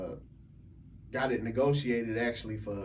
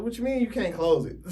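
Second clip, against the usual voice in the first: disbelieving outburst, higher and louder, half laughing; retold disbelief; 8 semitones higher, 3 dB louder than usual. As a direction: act stunned, the voice jumping up. Retold shocked reply to lender.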